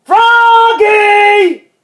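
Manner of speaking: loud, high, stretched-out name; exasperated parent's long shout; dad's yell. A narrator's reading. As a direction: bellow for the child at full voice. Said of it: "yelled Dad".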